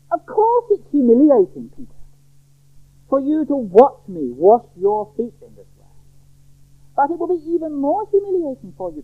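high-pitched, urgent reproof; disturbed remonstrance. Acted. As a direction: plead and reason with a reluctant disciple, pitch high. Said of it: "Jesus is profoundly disturbed by the reluctance".

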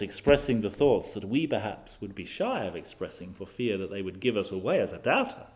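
ordinary talk, nothing marked

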